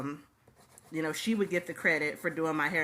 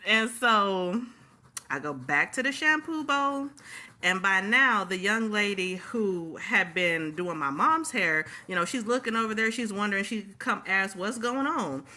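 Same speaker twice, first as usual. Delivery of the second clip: usual speaking voice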